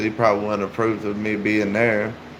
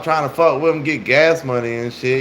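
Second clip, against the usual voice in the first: ordinary delivery, nothing marked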